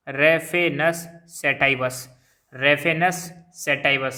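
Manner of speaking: "Raphanus sativus" said slowly, each word set apart by pauses; slow repeated dictation. Shown as a demonstration. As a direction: repeat "Raphanus sativus" clearly for the class to copy. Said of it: "मैं दो दो तीन तीन बार बोल रहा हूँ".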